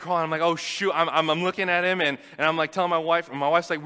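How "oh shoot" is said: alarmed exclamation. Retold or imitated. Baby starting to crawl.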